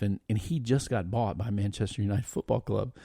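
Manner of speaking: usual speaking voice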